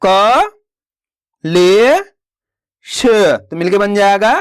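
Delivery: slow, separated syllables, teacher modelling; slow syllable-by-syllable reading. Shown as a demonstration. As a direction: sound each syllable out slowly for pupils. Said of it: "मेरे तरीके पे गौर करना है".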